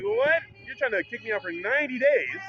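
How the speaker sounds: high, incredulous What; a hint of disbelief at the ban; mock outrage at ninety days; retold shock.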